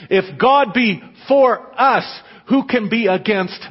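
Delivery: loud, slow, high scripture proclamation; emphatic preaching. Acted. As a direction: proclaim each word slowly.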